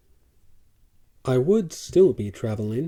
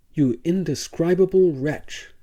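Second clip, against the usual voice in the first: loud, higher raging insult; furious berating; 5 semitones higher, 6 dB louder than usual. A narrator's reading. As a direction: hurl the insult at him. Dealer berating the slave.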